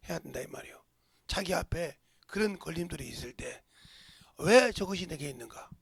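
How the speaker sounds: ordinary talk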